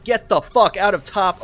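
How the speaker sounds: furious. Real, not acted. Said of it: "I'm getting so pissed off".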